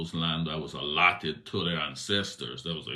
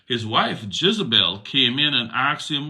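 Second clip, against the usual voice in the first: higher, louder reading voice; scripture reading; 9 semitones higher, 11 dB louder than usual. A narrator's reading. Preacher reading Jezebel's entrance, raised reading voice. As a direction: lift the narration into the scene.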